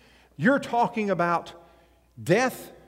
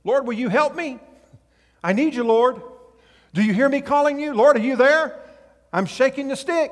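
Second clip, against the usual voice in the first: pleading prayer, higher and louder; desperate prayer; 6 semitones higher, 5 dB louder than usual. Retold or imitated. A believer in trouble calling on the Lord, pleading. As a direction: beg the Lord to answer. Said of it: "what do we do when we get in times of difficulty".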